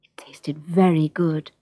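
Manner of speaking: ordinary delivery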